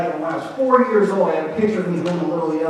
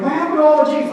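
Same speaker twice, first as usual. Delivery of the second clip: mock parents; 10 semitones higher, 5 dB louder, faster than usual. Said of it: "Guardians were like".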